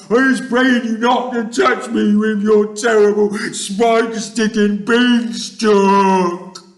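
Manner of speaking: high, loud, wailing plea; terrified screaming. A narrator's reading. Giant begging Jack and fearing beanstalk, screaming. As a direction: shriek and beg in panic. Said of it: "screamed the flesh lump eater".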